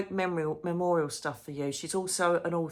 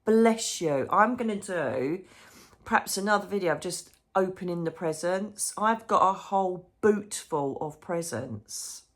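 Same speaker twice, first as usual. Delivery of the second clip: ordinary delivery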